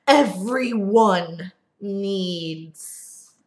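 everyone stretched very slowly, long held; slow right down and savour everyone needs; drawn-out everyone. A narrator's reading. Repeated word, third take.

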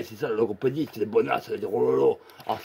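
usual speaking voice

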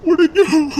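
tearful outburst to his mother; crying plea. Acted. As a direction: burst out through tears. Son pleads with mother, crying.

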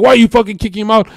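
fast, high, loud screaming rant; screaming dad. Retold or imitated. Dad on the phone screaming at the twin, loud and furious. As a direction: tear into him for kicking his brother out. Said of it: "he started screaming at him".

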